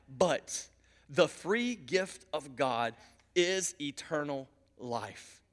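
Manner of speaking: low, soft and weighty, with long pauses; grave, quiet pulpit delivery; hushed solemn preaching. Real, not acted. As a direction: bring it right down and let each phrase settle.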